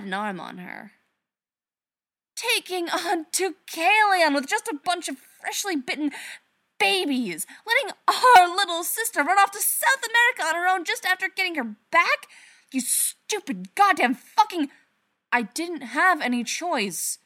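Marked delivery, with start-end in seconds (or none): 2.4-14.7 high, loud, furious rant; enraged screaming. Acted. Sister's enraged rant at brother. 15.3-17.2 protesting. Acted. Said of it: "Derek protested".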